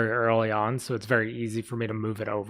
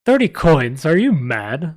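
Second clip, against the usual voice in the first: high, loud scoffing disbelief; 5 semitones higher, 9 dB louder, slower than usual. Acted. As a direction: scoff at the offer, outraged. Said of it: "the man scoffed".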